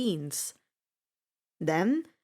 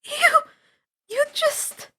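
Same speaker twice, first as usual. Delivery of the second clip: breathless shock; 4 dB louder, slower than usual. A narrator's reading. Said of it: "Annabeth breathed hard, eyes wide".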